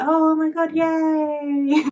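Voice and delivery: high, drawn-out mock squeal; delighted squeal. Asher getting her sparkly dress, squealing with mock delight.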